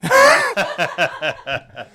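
talking through laughter; giggling out the words; laughing. Real, not acted. Laughing at Robbie's joke.

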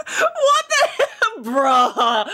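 real helpless laughter through the words; cracking up, stretching out the words in disbelief; laughing disbelief. Real, not acted. Listener cracks up at the story.

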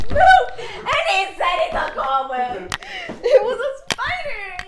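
loud, laughing outrage; outraged laughing shout. Real, not acted. Spider proven, outraged.

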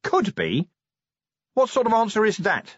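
high, indignant disbelief; incredulous. A narrator's reading. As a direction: throw the words back in disbelief. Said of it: "said Vimes".

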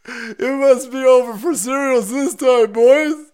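high, loud, slow fake wailing; mock despair. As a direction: lament it slowly in a hammed-up high cry. Pretends despair at Trump's end.

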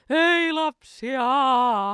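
high, slow drawn-out call from downstairs; dad calling up. Retold or imitated. Dad calling the kids from downstairs, loud drawn-out call. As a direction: call the kids from the floor below, stretched out. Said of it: "kun isä huusi alakerrassa".

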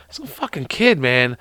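normal talking voice

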